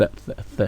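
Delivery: "f, f" blown out soundlessly, tongue between the teeth; unvoiced th. Shown as a demonstration. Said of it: "on va dire f". (th sourd, langue entre les dents)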